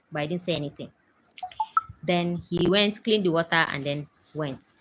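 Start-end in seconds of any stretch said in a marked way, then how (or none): none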